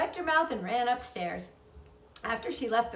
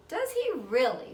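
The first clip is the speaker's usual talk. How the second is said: vaguely; 6 semitones higher, 4 dB louder than usual. Acted. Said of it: "rather vaguely".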